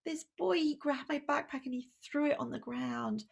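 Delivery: put-on a child telling his parent about the bully; a lightly voiced small boy.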